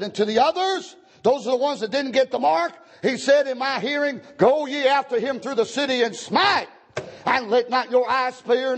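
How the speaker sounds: high, declaiming pulpit voice; ringing preacher's recitation; forceful scripture reading. Real, not acted. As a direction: proclaim the verses as a stern command.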